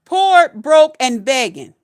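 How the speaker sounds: loud, mocking chant of the words; loud scornful rant. Real, not acted. Ranting at broke fifty-year-olds, loud scornful chant. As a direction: spit out the insult loud.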